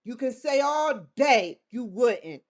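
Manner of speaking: ordinary talk, nothing marked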